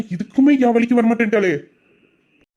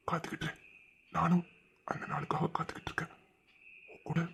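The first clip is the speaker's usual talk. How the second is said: hushed tender whisper; 4 semitones lower, 19 dB quieter than usual. Acted. He whispers back to his beloved, tenderly. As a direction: say it as a secret to her.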